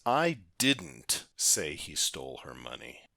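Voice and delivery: "didn't" hit firmly and stretched; stress on DIDN'T. Shown as a demonstration. Teacher showing how emphasis changes meaning, stress on "didn't". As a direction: punch the word "didn't", let the rest fall. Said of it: "the emphasis on didn't".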